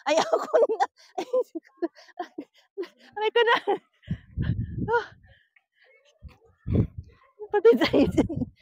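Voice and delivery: high, whimpering cry; fearful whimper. Real, not acted. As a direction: whimper in fear and pain. Painful crawl, cry heard.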